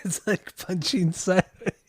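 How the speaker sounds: helplessly amused, voice lifted by giggles; laughing. Real, not acted. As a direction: repeat it while cracking up. Cracking up, repeating co-host's joke.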